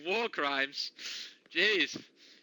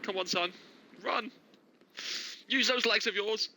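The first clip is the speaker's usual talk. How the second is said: urging shout, higher; cheering on; 6 semitones higher than usual. Real, not acted. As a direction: egg the runner on. Caster urging a runner on.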